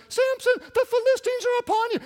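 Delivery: very high, loud falsetto woman; shrieking Delilah. Acted. Preacher as Delilah waking Samson, shrill alarm.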